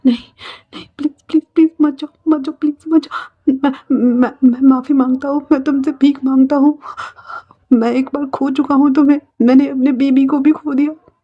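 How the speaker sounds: desperate begging. Acted. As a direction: beg them not to go, tripping over repeated words. Begs Wing not to leave.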